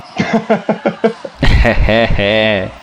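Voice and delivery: laughing. Real, not acted. Laughing at the pick.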